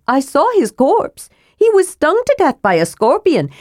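high, earnest little lecturer; pert, high-pitched reproof; scolding kender. A narrator's reading. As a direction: argue your case in a high voice.

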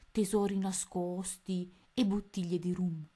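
ordinary delivery, nothing marked

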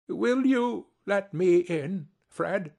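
put-on slow, hopeful old man asking; lightly voiced humble uncle; pleading old Scrooge. Scrooge asking his nephew to let him in, humbly.

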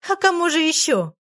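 гораздо выше, с насмешливым смешком; весело хохотни в ответ; с усмешкой. Acted. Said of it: "хохотнула Галка". (Галка весело подначивает, голос выше)

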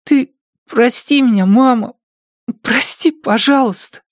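put-on tearful boy's voice, pleading; sobbing apology. A narrator's reading. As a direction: sob out the apology to mom. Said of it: "сказал сквозь слёзы". (плачущий мальчик просит прощения)

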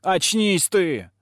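high, slow yell; shouting. A narrator's reading. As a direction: shout him awake. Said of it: "закричала Огнянка". (крик)

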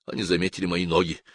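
ordinary delivery

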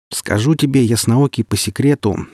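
ordinary delivery, nothing marked

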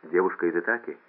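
usual speaking voice

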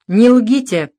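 a stern rebuke; firm, severe warning. A narrator's reading. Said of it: "строго ответил Дронго".

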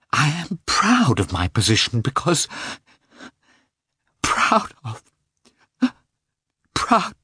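rising high, breaking off; choked with tears. A narrator's reading. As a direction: choke up on the last proud. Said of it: "Tears of shame and despair choked her".

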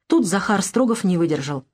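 plain reading voice

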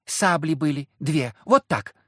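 put-on high, loudish child's voice; young girl. A narrator's reading. Girl shows the hunter the crossed sabres, in a child's voice. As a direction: explain it like an eager little girl.

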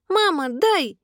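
put-on high toddler plea; high-pitched insistent childish cry; demanding little boy. A narrator's reading. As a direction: beg mummy in a small child's voice. Boy begs mother in story.

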